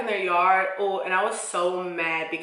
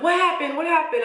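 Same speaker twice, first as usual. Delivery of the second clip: high, loud, urgent imitation; alarmed questioning; 9 semitones higher, 4 dB louder than usual. Little cousin asking after the attack, high urgent questioning. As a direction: fire the question twice, imitating an alarmed kid.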